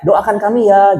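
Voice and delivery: high-pitched imitation of a contestant calling out; mock cheerful shout. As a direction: call out like an eager game-show contestant. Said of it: "Dia selalu teriak".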